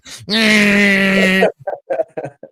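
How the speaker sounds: high, sustained sander buzz; long droning machine whine; imitated sander noise. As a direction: mimic a power sander grinding away.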